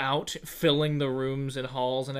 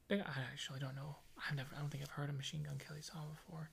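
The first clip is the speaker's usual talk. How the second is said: whispered aside; 2 semitones lower, 17 dB quieter, faster than usual. Real, not acted. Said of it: "whispered confession aside".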